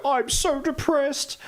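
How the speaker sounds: very high, slow, hammed-up misery; mock depressed sibling. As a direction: imitate an overdramatic kid, high and dragged out. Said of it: "They don't quite say it like this, but they go".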